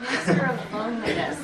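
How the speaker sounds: laughing out the words loud and high; cracking up mid-phrase. Real, not acted. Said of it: "It's not funny, but interesting".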